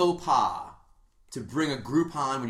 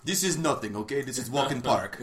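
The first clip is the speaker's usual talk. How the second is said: bad Russian accent. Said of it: "Sorry for my horrible Russian accent".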